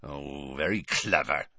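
low, quiet sarcastic growl; Hagrid growling. A narrator's reading. Hagrid answering Harry's comeback, low growl. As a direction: growl it under your breath. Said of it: "growled Hagrid".

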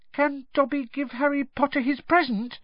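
high, squeaky, hesitant elf voice; tentative squeak. A narrator's reading. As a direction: ask shyly in a squeaky little voice. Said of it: "he squeaked tentatively".

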